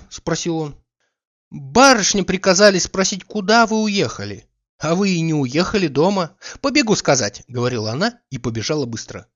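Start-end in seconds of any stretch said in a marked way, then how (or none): 1.5-7.4 put-on higher, lively girl's voice; lightly voiced young maid. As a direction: play the quick little maid.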